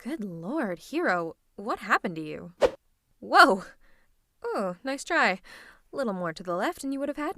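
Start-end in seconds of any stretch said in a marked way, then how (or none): 3.2-3.7 loud startled exclamation; sudden loud burst of surprise. Acted. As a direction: cry out as you dodge. Villain startled, dodging an attack.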